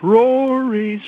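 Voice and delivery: high drawn-out call of his name; a mom calling her kid in from the yard. Retold or imitated.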